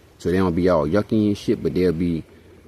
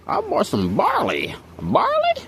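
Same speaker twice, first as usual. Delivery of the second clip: squeaky high barley; slower than usual. Pretend. Squeaky high mock word.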